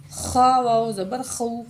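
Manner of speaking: "خا" with a rasped throat fricative; throaty kha. Shown as a demonstration. Teacher drills the letter kha, "خا" with throaty friction. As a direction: scrape the first sound of "خو". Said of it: "خا پڑھنا ہے".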